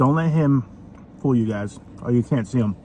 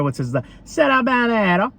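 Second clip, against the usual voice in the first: mock Italian accent on "Serabanero", pitched up; 10 semitones higher than usual. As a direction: toss off "Serabanero" like a jokey Italian. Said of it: "I said that like an Italian person".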